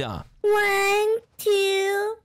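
drawn-out counting call. Real, not acted. Seeker counts while brother goes hiding: drawn-out call. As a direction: call each number out to the house.

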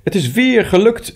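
high, elated cry of success; excited call. A narrator's reading. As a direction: call it out brightly as you come in. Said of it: "riep hij".

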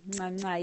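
two quick kiss sounds into the mic; blown kisses. Real, not acted. Host blowing kisses to her live viewers, affectionate smacks.

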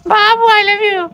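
put-on high, loud girly voice; girl's voice. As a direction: squeal it sweetly like a girlfriend. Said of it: "एक बार और।".

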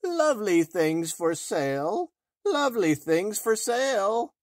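high, loud hawker's cry; an old peddler calling at the door, pitched high; calling peddler woman. A narrator's reading. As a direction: crone crying her wares. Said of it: "and called".

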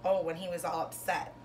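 lilting picture-book read-aloud, bigger on each Llama Llama; reading to children. Acted. Teacher reading a rhyming bedtime book to her class. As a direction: read it like a bedtime story for little kids.

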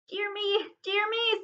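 high-pitched, fretful little exclamation; flustered hobbit. A narrator's reading. Hobbit answers the angry dwarf king, flustered and high.

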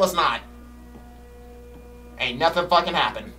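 ordinary talk, nothing marked

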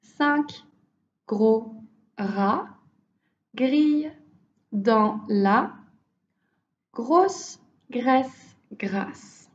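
slow, word by word demo. Shown as a demonstration. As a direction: pause after each word. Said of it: "word by word first".

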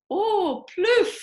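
high, gleeful "plouf"; excited splash. Acted. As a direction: squeal "plouf" as you splash. Rabbit splashing in mud.

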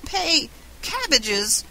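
high, slow, incredulous exclamation; shocked gasp. A narrator's reading. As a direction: draw out each word in disbelief, pitched high. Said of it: "gasped Mister Morehouse".

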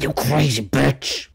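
slow, drawn-out taunt; slow mocking drawl; mock insult. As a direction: drag it out teasingly.

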